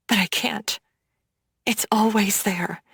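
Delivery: breathy unvoiced whisper, barely there. A narrator's reading. Woman haunted by her past, whispering to herself. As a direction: let the fear slip out as a whisper. Narrator's haunted inner thought.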